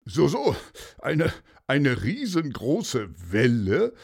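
a touch winded, stumbling over the start; mildly out of breath. A narrator's reading. Commissioner just arrived, questioning the horse, slightly winded. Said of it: "Er war etwas außer Atem".

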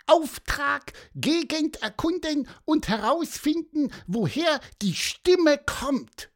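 very high squeaky mission briefing; self-important chirp. A narrator's reading. Pieper states his scouting mission, high and self-important. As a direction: read out orders like a tiny bird officer. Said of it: "piepste er wichtig".

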